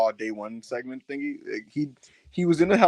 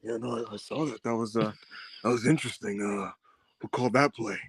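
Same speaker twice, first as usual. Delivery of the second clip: low, subdued wrestler imitation; quietly probing, pitched down; Roman; 4 semitones lower, 6 dB quieter than usual. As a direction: feign mild interest, voice dropped. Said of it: "And Roman's like".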